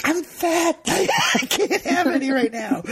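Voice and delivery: high-pitched comic protest through a laugh; mock protest. Mock protest against offered candy.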